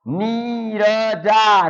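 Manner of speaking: very slow, syllables apart; slow separated syllables. Shown as a demonstration. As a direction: split the word into its sounds. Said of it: "मतलब इसे आप मिला के बोलेंगे".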